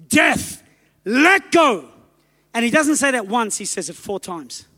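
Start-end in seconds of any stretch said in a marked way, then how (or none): 0.1-1.9 rising commanding rebuke; Wigglesworth commanding death. Retold or imitated. Preacher retelling Wigglesworth's command to death. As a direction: order death out of the body. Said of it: "And he goes".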